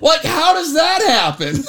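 high, slow, incredulous cry; baffled outburst on the edge of laughter; loud disbelief. Retold or imitated. Retold disbelief, breaking into laughter.

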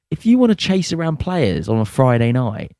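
ordinary talk, nothing marked

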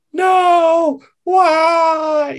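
high, very loud, drawn-out wail; hammed-up melodramatic scream to the heavens; over-the-top anguished cry. Grandfather drops to his knees outside, hammed-up wail. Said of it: "really over the top acting scene".

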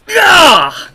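high, loud outraged cry; outraged gah. Acted. Shopper finding no ham, loud outraged gah. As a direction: cry out in mock horror. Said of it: "They're out of ham".

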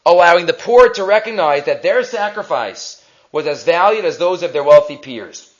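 ordinary talk, nothing marked

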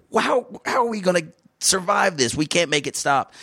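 high, panicked inner voice. Retold or imitated. His own brain panicking over the flood, high and frantic. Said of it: "my brain's like".

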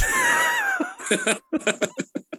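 long, high cackling laugh; real laughter. Real, not acted. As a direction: lose it at your own joke. Laughs at own Canadian headline.

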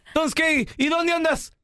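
high, nagging wife voice, half-joking; angry wife voice. Retold or imitated. Wife calls her late husband, high mock scolding. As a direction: demand to know where he is, mock scolding. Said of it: "Y le dice".

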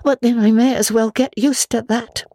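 put-on a lonely girl making the best of it; young Anne. A narrator's reading. Anne's voice in the story.

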